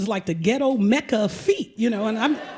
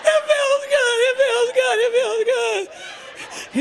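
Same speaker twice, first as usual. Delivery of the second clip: hammed-up ecstasy; 13 semitones higher, slower than usual. Client giving in to the foot massage, ecstatic cries. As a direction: swoon theatrically at the foot rub. Thumb in her sole, ecstasy.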